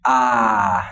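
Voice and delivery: long, open "ah"; open "ah" sound. Shown as a demonstration. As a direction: hold "ah" as a demonstrated vowel. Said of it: "we have the".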